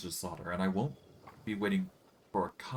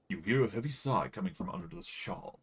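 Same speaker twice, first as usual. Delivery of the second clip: ordinary talk, nothing marked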